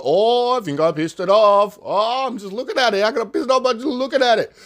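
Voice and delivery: high, rushed, worried muttering; fast, high mock fright; nervous alarm. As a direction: talk yourself through rising dread. Retold fear at the creature.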